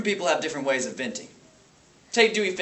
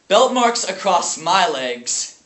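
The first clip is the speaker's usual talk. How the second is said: loud, slow, raised emphasis; pained anger; 4 semitones higher, 8 dB louder, slower than usual. Acted. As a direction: let anger break through the memory. Anger breaks into painful memory.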